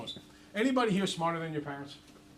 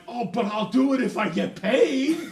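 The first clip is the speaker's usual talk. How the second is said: loud, high, slow, giggling; cheeky laughing bargain; 7 semitones higher, 12 dB louder than usual. Retold or imitated. Boy asking his dad for pay, laughing. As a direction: sell it with a laugh. Own quote.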